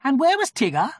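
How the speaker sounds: high, brisk rabbit voice; fussy rabbit asking; busy Rabbit voice. A narrator's reading. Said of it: "asked Rabbit".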